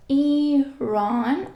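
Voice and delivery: Iran split slowly, "eee-rahn"; drawn-out first sound. Shown as a demonstration. Teacher isolating the first vowel of Iran for learners, drawn-out "ee". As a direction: stretch the opening "ee" of Iran. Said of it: "E sound".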